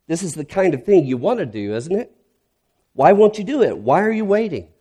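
frustrated rant at God; ranting prayer. Retold or imitated. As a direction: demand answers from God, voice climbing. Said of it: "These are my rants".

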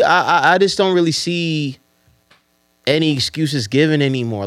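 ordinary delivery

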